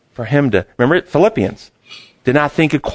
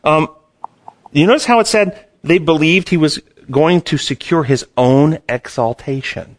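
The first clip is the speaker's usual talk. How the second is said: normal talking voice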